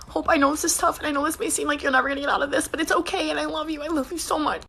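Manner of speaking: imitating a sister crying, half-hearted; mock crying sister. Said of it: "She starts crying".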